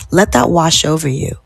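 ordinary talk

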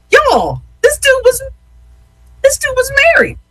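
high-pitched incredulous exclamation; scandalized disbelief. Real, not acted. Host telling listeners a shocking show twist, high scandalized disbelief.

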